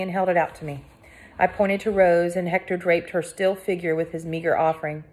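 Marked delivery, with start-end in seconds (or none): none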